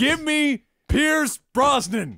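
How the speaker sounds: loud, high, drawn-out demand; emphatic insistence. Real, not acted. Podcaster defending his celebrity pick to a co-host, emphatic insistence. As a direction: belt it out slowly, word by word.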